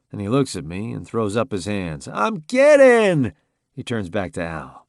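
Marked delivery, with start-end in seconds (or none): 2.1-3.3 a high, loud, drawn-out protest of innocence. Acted. Max to a growling friend, a loud, high I'm kidding. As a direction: cry it out like you're caught and backing off. Max throws up his hands.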